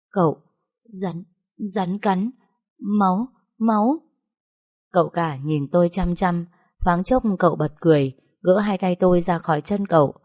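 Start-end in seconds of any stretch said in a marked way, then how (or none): 0.1-4.0 halting, breathless panic, slow and broken; terrified stammer. A narrator's reading. Girl stammering to her master after a snakebite, terrified. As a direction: gasp out the words in fright. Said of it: "tôi vừa mếu máo run rẩy, nói không nên lời".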